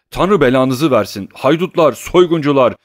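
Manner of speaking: high, loud insults; furious cursing. A narrator's reading. Tarantiyev curses the household as he leaves, high and loud. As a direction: hurl curses on the way out. Man curses them while storming out.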